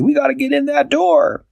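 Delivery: high, urgent cat voice; urgent Barnaby. Acted. Barnaby urging them to break in, high urgent voice. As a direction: push them to act. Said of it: "Barnaby said".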